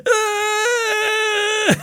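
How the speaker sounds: high strained wail; crying shriek. Retold or imitated. Boy on the ground wails, high and strained. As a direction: let out a high strangled wail.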